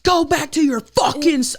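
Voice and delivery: high-pitched angry mock yell; imitating your own furious yelling; re-enacted screaming. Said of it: "you just screaming at this lady".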